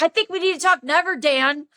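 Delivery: high, fast mocking comeback; going off. Tipsy woman rejecting Dan's suggestion, indignant. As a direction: throw his words back, pitch jumping up. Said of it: "I went off on him".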